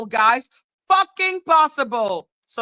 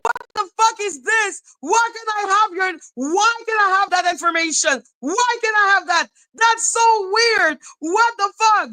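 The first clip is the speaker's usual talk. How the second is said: much higher, outraged questioning; indignant disbelief; 9 semitones higher than usual. Retold or imitated. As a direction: act out outrage at the lax laws. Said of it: "I was like".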